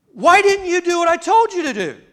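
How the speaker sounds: high, loud divine rebuke; voice of God. Acted. Preacher voicing God, rebuking the people. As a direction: demand it as the Lord confronting his people.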